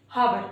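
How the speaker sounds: "हावर" loud, with a spoken h; teacher shows the mistaken "हावर" loudly; wrong h sound. Shown as a demonstration. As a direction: push an h onto "हावर". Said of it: "ये wrong होते हैं".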